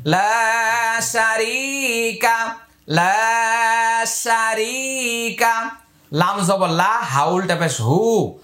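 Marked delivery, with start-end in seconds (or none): 0.1-5.7 slow, drawn-out Quranic recitation. Shown as a demonstration. Teacher modelling the phrase for learners to memorise, slow chant. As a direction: draw each vowel out like a reciter. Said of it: "এইটাও তাড়াতাড়ি।".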